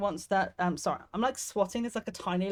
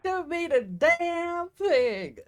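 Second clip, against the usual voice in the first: high, slow yokel echo; 11 semitones higher, 5 dB louder, much slower than usual. Retold or imitated. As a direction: parrot the game's hillbilly line. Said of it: "I love the voices in this".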